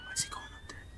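hushed whisper so the monster won't hear; frightened whisper. Real, not acted. Player hiding from the zombie, tense whisper.

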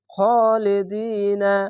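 slow, measured Qur'an reading with খা and দি stretched in খালিদিনা; drawn-out recitation. Shown as a demonstration. Teacher showing students how to read খালিদিনা, slow and drawn out. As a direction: hold the long vowels of খালিদিনা for one alif. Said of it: "এক আলিফ টেনে পড়বেন".

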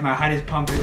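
ordinary talk

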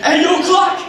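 loud holler across the store; calling out. Acted. Customer hollering for the clerk across the store, loud call. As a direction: shout for the clerk from afar.

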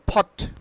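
short ফট opening on an aspirated p; aspirated P in POT. Shown as a demonstration. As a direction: say ফট with a puffed ph at the start. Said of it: "পটকে আমরা কি বলবো?".

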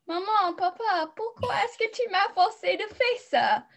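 put-on high, pleading child's whine to her parents; whiny kid complaining. Student imitates her younger self complaining to her parents, high whiny plea. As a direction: play the younger self begging mom and dad. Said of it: "j'étais un peu comme".

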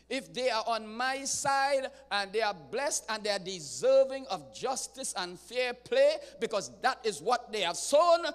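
loud fervent sermon; preaching at full force. Acted. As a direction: build the fire in the pulpit. God's voice, building preaching.

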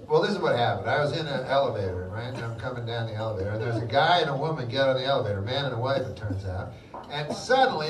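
usual speaking voice

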